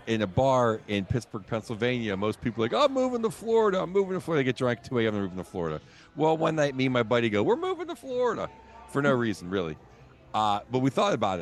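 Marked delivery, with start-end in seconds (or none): 2.7-4.4 put-on drunk bar regular. 7.4-8.5 excited. Retold or imitated. Retold declaration with buddy.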